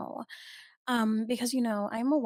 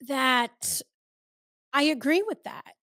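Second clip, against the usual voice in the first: normal talking voice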